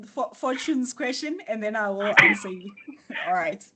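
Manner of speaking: normal talking voice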